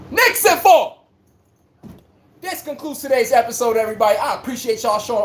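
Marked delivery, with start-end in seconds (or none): 0.1-1.0 loud, much higher hype call; hype shout. Real, not acted. Knicks fan calling a sweep to the chat, loud high shout. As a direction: shout it like a victory prediction.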